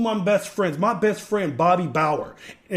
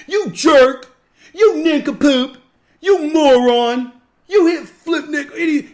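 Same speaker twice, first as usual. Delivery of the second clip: louder, higher mock yell of insults; mock angry insults; 6 semitones higher, 7 dB louder, slower than usual. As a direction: spit out the insults in mock anger. Imagined insults from angry person.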